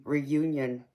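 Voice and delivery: ordinary delivery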